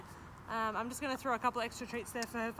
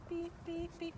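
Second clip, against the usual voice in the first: high, quiet beeping cue to the dog; 7 semitones higher, 6 dB quieter than usual. Shown as a demonstration. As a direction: chirp the beeps softly and high, like a signal. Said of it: "when I beep, she backs up".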